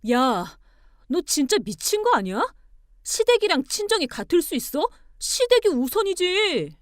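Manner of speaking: loud, high angry insistence; outraged. Acted. Husband insisting his family comes first, outraged. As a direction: climb to a high, loud declaration.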